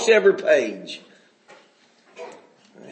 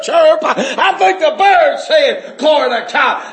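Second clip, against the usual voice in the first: higher and louder, building with laughter; rising joyful preaching; 5 semitones higher, 4 dB louder than usual. Real, not acted. Preacher to his congregation, climbing into joyful shouting.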